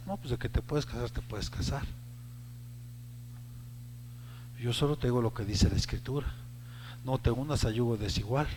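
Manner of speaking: quiet fatherly advice. Retold or imitated. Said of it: "imagínense la escena".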